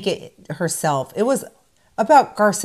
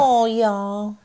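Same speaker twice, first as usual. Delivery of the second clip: fake Southern accent. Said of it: "fake Southern accent".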